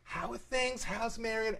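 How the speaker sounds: high-pitched imitation of a chatty aunt; Aunt Rhoda. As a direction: put on the aunt's high voice for small talk. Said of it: "So she's like".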